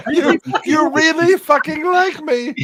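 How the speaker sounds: overjoyed winner, half laughing; laughing mock speech. Friend parodying an Oscar speech, laughing squeal. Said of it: "And the Oscar goes to".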